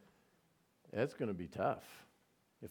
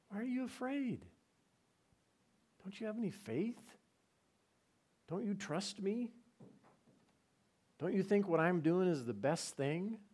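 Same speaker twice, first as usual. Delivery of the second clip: higher, softer pleading voice; much higher, softer loving question; gentle divine question; 7 semitones higher, 5 dB quieter than usual. Retold or imitated. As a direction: ask tenderly, as God to a doubter. God speaking to listener.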